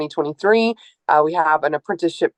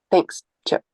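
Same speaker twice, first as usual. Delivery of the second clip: emotional thanks. Real, not acted. Speaker thanking her mentor Chip, real choked-up emotion. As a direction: thank him as the tears come. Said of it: "I don't know why I'm getting emotional".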